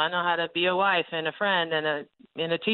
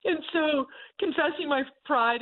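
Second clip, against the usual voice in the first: laughing; 8 semitones higher than usual. Real, not acted. Laughing admission of pride.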